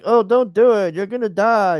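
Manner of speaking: hammed-up worried protest; mock alarmed plea. Acted pleading warning from the family.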